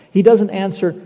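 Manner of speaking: ordinary delivery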